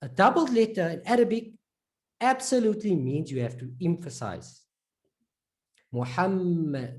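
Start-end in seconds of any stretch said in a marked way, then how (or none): 5.9-7.0 Muhammad slowly, holding the middle M; held M. Shown as a demonstration. Teacher advising to hold it a bit, held M. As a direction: linger on the M in Muhammad. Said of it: "just hold it a bit".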